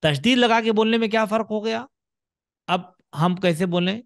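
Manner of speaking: ordinary delivery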